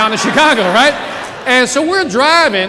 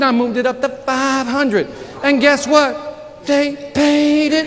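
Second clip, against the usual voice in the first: slow triumphant reveal; 2 semitones higher, slower than usual. Acted. Building price story for effect.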